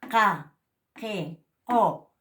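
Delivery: "Ka, ke, ko" said loudly with a glottal q; glottal q demo. Shown as a demonstration. Teacher demonstrating the glottalized q. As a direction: sound out the q syllables loudly from the throat. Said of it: "Así se pronuncia esto".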